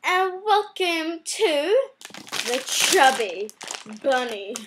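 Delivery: ordinary delivery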